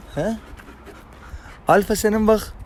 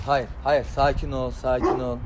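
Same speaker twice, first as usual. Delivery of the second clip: softer, low calming murmur; soothing; 3 semitones lower, 8 dB quieter than usual. Real, not acted. Owner softly calms his agitated dog after the scuffle. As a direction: hush the dog down gently.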